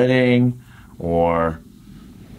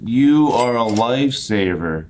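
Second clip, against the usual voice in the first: "You are a lifesaver" in full, leaning hard on "You" and "lifesaver"; emphatic gratitude; 2 semitones higher, 3 dB louder than usual. Shown as a demonstration. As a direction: say "You are a lifesaver" as if truly rescued, each word weighted. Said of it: "You gotta say it like that".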